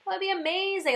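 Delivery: mocking my past self, who longs for martyrdom, gushing; mock eager martyr. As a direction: play your own naive zeal for martyrdom. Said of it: "I'm like".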